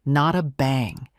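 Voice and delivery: ordinary delivery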